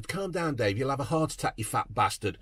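lightly voiced patronising commenters; mocking concerned viewers. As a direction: parody calm-down tone, dropped low and quiet. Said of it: "People are going".

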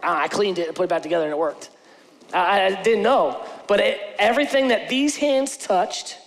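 normal talking voice

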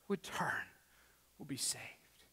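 breathy hushed whisper. Real, not acted. Pastor telling the church of salvation, whispered. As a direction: drop to a whisper of awe.

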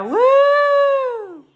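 high, loud whoop; excited cheer. Real, not acted. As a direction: cheer like the ball just dropped. New Year's Eve cheering.

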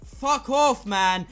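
yelling in frustration; furious gamer outburst; angry shout. Real, not acted. Raging at enemy in game.

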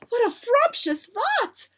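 high-pitched excited exclamation; delighted witches' cry. A narrator's reading. Witches praise their leader's idea, delighted. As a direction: squeal it like a thrilled follower. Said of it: "cried the witches".

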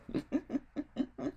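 soft staccato chuckle; acted chuckle. A narrator's reading. Mister Cheerful starts laughing at Miss Bossy, soft chuckle. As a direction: chuckle quietly in character. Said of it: "Mister Cheerful started to chuckle".

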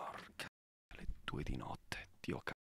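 real whisper, soft and unvoiced. Real, not acted. Alone at two in the morning, whispering a curse. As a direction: breathe it out so no one hears. Muttering alone late at night.

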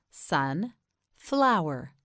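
"Sun, flower" as two separate words; split in two. Shown as a demonstration. Teacher models for learner, "Sun, flower" broken in two. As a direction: put a clear pause between "Sun" and "flower". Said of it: "You say it".

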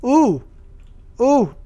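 excited. Real, not acted. Excited find in the game.

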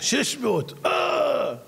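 high rising gasp of alarm; mock alarm. Salesman to speaker, faking alarm at the reading. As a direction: act horrified at the number.

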